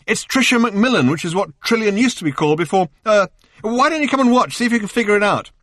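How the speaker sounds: high, loud confused shouting; agitated calling. A narrator's reading. Arthur shouting his confusion through to Ford, high and loud. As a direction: call out, flustered and bewildered.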